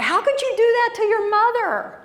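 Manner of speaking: high, loud disbelieving question; shocked classmate. Retold or imitated. A classmate asks how you could hurt your mother, high and shocked. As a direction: imitate a kid appalled at what you did.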